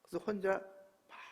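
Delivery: normal talking voice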